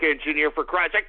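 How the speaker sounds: ordinary talk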